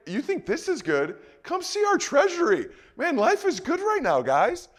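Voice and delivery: high, bragging and delighted; boastful glee. Retold or imitated. Hezekiah shows off his treasury to guests, high and bragging. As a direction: show off your riches with glee.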